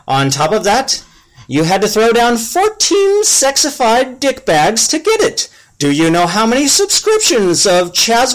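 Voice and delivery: high, exasperated elf complaining. Acted. Yobobins complains to friend.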